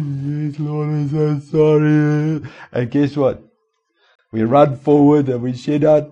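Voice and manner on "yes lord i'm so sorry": slow, higher, mock-pious sorry; mock contrite churchgoer. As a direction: imitate a weepy penitent, drawn out. Mocking tearful repentance at altar.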